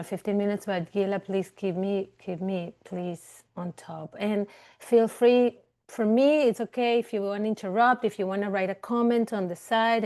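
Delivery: usual speaking voice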